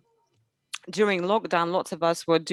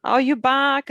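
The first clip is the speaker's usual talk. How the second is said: put-on child voice; 7 semitones higher, 4 dB louder, slower than usual. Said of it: "When I come back".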